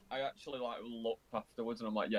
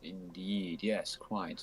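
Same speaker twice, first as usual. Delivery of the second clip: low posh gentleman; 6 semitones lower, much slower than usual. Pretend. Posh voice reply.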